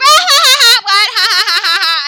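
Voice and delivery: very high, loud fake laugh; hammed-up imitation of her cackle; mock cackling. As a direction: shriek with obnoxious laughter. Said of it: "And she's like".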